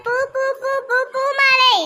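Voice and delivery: high-pitched flower seller's cry; street vendor's call. Acted. Flower seller drawing customers from a rival, high vendor's call. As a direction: hawk your wares in repeated calls.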